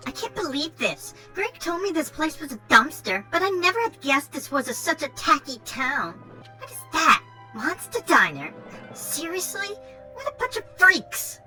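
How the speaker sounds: put-on spoiled Valley Girl brat; Valley Girl accent. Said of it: "Valley Girl accent going".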